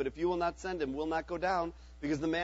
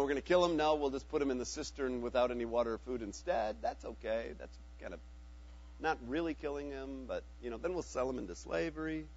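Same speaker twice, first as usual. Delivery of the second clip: mock casual brothers; 4 semitones lower, 7 dB quieter than usual.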